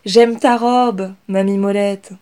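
Inès exclaims to her grandmother; exclaiming. Acted. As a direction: cheerfully praise her dress. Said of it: "s'exclama Inès".

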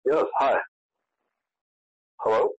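ordinary delivery

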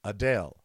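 Adele said as uh-DALE; corrected pronunciation. Shown as a demonstration. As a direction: say Adele rhyming with pale. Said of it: "uh, and then [name]".

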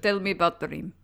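rough attempt at a Russian accent. Shown as a demonstration. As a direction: fake a Russian accent passing for German. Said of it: "Do a Russian accent".

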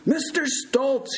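slow, high, drawn-out holler. A narrator's reading. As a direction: holler the name to rouse someone silent. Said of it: "he shouted even louder".

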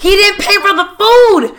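high, loud, breathless-style outburst; worked-up explaining. Retold or imitated. Passenger explaining the getaway to the cab driver, high worked-up outburst.

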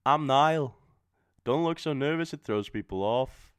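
put-on rough British accent for Niall. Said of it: "I can't do a British accent, but I'm gonna try".

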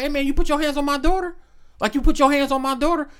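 high, fast accusing; a fast outraged challenge; confronting dad. Retold or imitated. Dad confronts the boyfriend about his daughter, fast and outraged. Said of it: "he was like".

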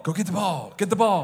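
high, excited talk to a dog; hammed-up, high-pitched fetch call; playful dog command. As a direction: rile up a dog for a game of fetch. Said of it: "It's like telling the dog".

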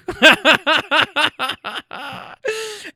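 high, long staccato cackle. Shown as a demonstration. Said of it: "doing my best redneck impersonation".

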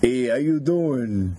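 slow, drawn-out South Boston greeting; badly faked South Boston accent. Shown as a demonstration. Cohost demonstrates his South Boston, slow and unconvincing. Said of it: "I'll do South Boston".

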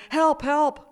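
high, loud cry of alarm; high-pitched yell; crying out for help. A narrator's reading. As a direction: call out for help at full voice. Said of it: "she cried again".

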